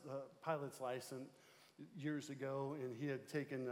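normal talking voice

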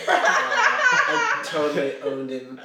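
laughing loudly, slow. Real, not acted. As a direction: laugh through the boast. Laughs at own retold comeback.

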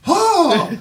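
very high, squeaky mock gasp of "oh"; a high, shocked cartoon elf cry; imitating a shocked elf. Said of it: "this one elf is just like".

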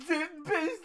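high, garbled yelp of the name; comic mangled battle cry; punch-drunk character's cry. Acted. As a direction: cry the name out with its sounds squashed. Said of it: "he just kinda goes".

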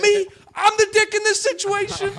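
very high, loud mock outrage; indignant disbelief. Comic to heckler who insulted him, indignant. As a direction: squawk it in retold disbelief.

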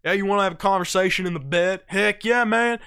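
loud, hammed-up country buddy talking over the truck bed; a big mock country guy; country drawl. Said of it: "they always call it".

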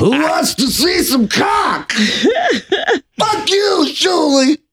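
put-on high shouted drunk voice; Jeff impression. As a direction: yell it high and slurry. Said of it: "I'll walk around talking as Jeff".

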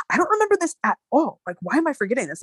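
imitating his upset, high-pitched at first; mock distressed protest, close to crying; upset, near tears. Friend upset at forgetting the story, about to cry. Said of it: "really upset. Like, he's about to start crying".

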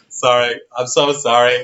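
normal talking voice

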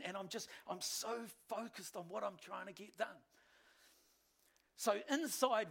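ordinary talk

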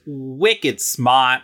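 drawn-out bad Boston accent; hammed-up Boston twang; terrible Boston accent. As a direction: put on a clumsy, slow Boston accent. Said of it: "That was a terrible Boston accent".

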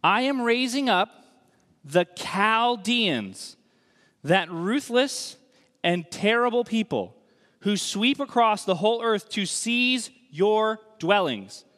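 slow, loud proclaiming of God's words; weighty slow Bible reading; scripture proclamation. Acted. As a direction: declaim it like a prophet. Reads God's words in Habakkuk.

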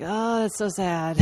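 lightly acted, drawn-out sympathy; imitating partner's sad reaction. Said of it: "you were like".

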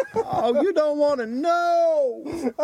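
slow, put-upon reply; weary victim voice. Acted. The corn-dogged man answers his taunter, slow and rueful. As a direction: drag it out like a haunted survivor.

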